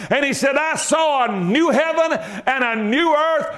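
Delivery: rising, shouting preacher's cadence, builds to a roar; preaching at full force. Acted. Preacher to his church, preaching at full force. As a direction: preach it to the congregation.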